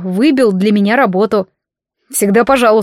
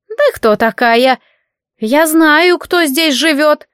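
put-on old hag; 7 semitones higher, slower than usual. A narrator's reading. Old neighbour interrogates.

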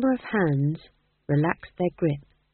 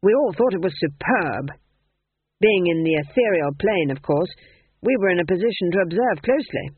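put-on pompous old king; 5 dB louder than usual. Ghost king voiced.

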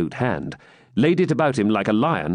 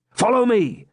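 much higher final order; firm command; 12 semitones higher than usual. A narrator's reading. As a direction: insist he follow now. Swineherd repeats order.

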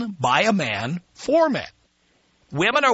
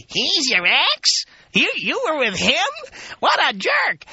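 high, mocking disbelief rising to a shout; scornful gossip; 9 semitones higher than usual. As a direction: sneer it like a nosy acquaintance. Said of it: "have to hear people say".

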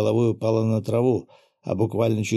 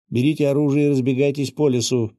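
mildly raised voice, a bit higher; called-out order; 4 semitones higher, 4 dB louder than usual. A narrator's reading. Commander ordering freed slaves to scatter into the woods, mildly shouted. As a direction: call out an urgent order, restrained. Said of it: "крикнул я через одного из жучков".

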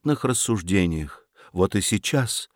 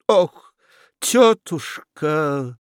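a drawn-out weary sigh of a word; 2 semitones higher, much slower than usual. A narrator's reading. Fedyashev sighs at his aunt, long and weary. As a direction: breathe it out slowly in despair. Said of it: "вздохнул Федяшев".

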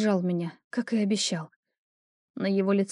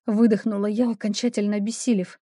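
usual speaking voice

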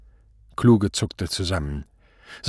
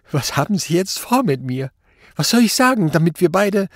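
high, tearful, increasingly urgent pleading; whimpering; 9 semitones higher, 5 dB louder than usual. A narrator's reading. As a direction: whimper and beg, voice climbing higher and louder. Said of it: "Er schniefte und winselte". (winselnd, flehend)

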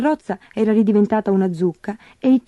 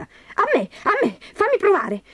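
very high, eager squeal; squeaky, pushy pleading; clamouring stepsister; 13 semitones higher than usual. A narrator's reading. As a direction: demand your turn like a greedy child. Stepsisters squabble to try the shoe.

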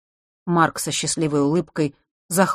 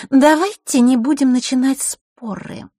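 put-on high, louder girlish appeal; Маришка; 9 semitones higher, 6 dB louder than usual. A narrator's reading. Girl pushes two boys apart, high-pitched appeal. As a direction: break it up in a high voice.

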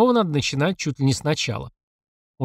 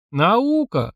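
smirking, wryly amused; a crooked little smirk on it; 7 semitones higher than usual. A narrator's reading. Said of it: "криво усмехнулся Святослав".

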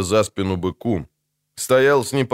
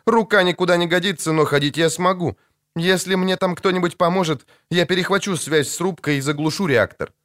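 Naomi's voice; 9 semitones higher than usual. Acted. Narrator voices injured Naomi.

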